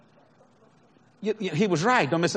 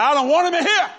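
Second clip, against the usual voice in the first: raised, indignant complaint; angry customer; 6 semitones higher, 6 dB louder than usual. Retold or imitated. Diner complaining loudly to the manager, indignant. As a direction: demand it like an offended diner.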